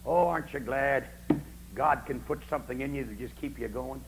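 normal talking voice

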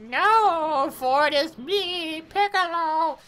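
high, slow grand villain reveal; mock Piccolo. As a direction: announce yourself grandly as Piccolo. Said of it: "You're like".

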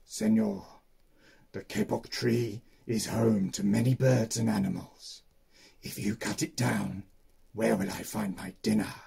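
growling jaguar. Acted. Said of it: "He growled in his ear".

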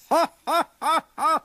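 high booming foolish guffaw; silly loud laugh. A narrator's reading. Bull laughing at the tiny mouse, loud silly guffaw. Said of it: "sciocca risata del toro".

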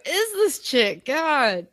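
high, slow, drawn-out disbelief; mock exasperation. Annoyed commenters reacting to the newcomer, mock exasperation. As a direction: stretch the question high in mock outrage.

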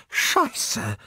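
high-pitched horrified curse. A narrator's reading. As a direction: swear in shock, high. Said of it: "In ihrem Ton war echtes Entsetzen". (entsetzt, hoch geflucht)

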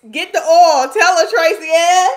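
loud high shouted cheer; excited hype. Real, not acted. Urging on Tracy Ann, a loud high excited shout.

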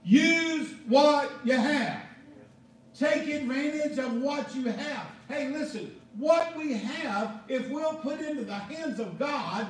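loud, driving pulpit preaching with hammered words; full-force preaching. Real, not acted. Pastor preaching to the congregation, loud and driving.

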